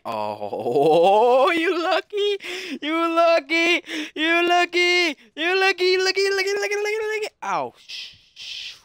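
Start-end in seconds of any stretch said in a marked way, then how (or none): 0.1-7.3 high, repetitive wail speeding into rapid repeats; rising taunt. Real, not acted. Gamer heckling a lucky opponent, high taunting chant.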